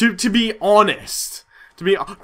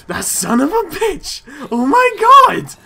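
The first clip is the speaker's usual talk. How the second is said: high, loud outraged yell; 9 semitones higher, 7 dB louder, slower than usual. Real, not acted. Drawn-out furious cry. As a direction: gamer realizing his target tricked him, outraged. Realizes he was tricked.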